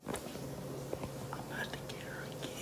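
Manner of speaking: sneaky whisper before a prank; hushed, conspiratorial whisper. Real, not acted.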